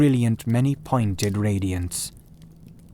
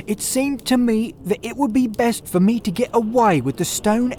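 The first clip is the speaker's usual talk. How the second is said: higher, anxious confession; a frightened thief starting his confession; nervous Ryder; 10 semitones higher, 3 dB louder than usual. A narrator's reading. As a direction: tell it quickly, still shaken.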